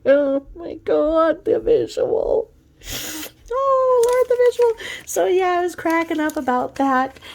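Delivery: laughing hard. Real, not acted. Picturing a doctor running in with a javelin needle, laughing. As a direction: squeal it out while cracking up.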